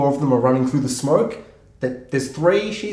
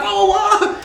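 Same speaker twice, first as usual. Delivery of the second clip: high, louder mock startle; startled whoa; 4 dB louder than usual. Jon Snow startled by Melisandre, mock shock. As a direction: jump back in hammed-up shock. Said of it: "And he's like".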